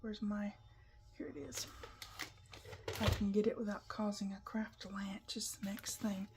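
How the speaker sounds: ordinary talk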